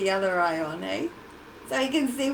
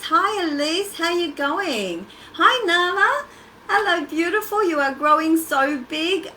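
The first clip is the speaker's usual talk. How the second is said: 1 excited greeting; 6 semitones higher, 7 dB louder than usual. Real, not acted. Greeting viewers, baby Nala.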